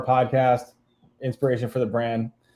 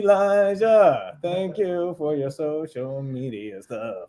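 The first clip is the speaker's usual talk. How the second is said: high, slow theatrical thanks; hammy thanks; 4 semitones higher, much slower than usual. Pretend. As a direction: drag out an overdone thank-you. Hammy thank-you to Elijah.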